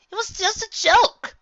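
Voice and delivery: high, cocky pony, slow and loud on lighten up. Acted. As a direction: coax a grumpy friend with a grin. Rainbow Dash character voice.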